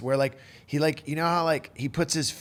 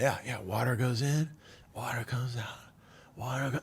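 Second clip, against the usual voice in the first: trance-like mantra; 3 semitones lower, 7 dB quieter than usual. Retold or imitated. Repeating Herbie's mantra, trails off quietly.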